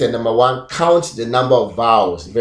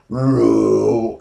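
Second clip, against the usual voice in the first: "ro" drawn out slowly, then stopped; robot speak. Shown as a demonstration. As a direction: hold "ro" long and pause. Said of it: "and I'll pause it".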